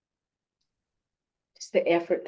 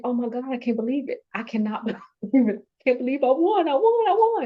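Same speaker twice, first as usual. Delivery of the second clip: rising, much higher, fast and giddy, climbing to a squeal on I won; retold disbelief; 7 semitones higher, faster than usual. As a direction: relive the moment you learned you won. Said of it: "I was like".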